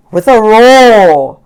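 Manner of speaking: loud, slow drawn-out roar. A narrator's reading. Narrator roars as the big cars start moving.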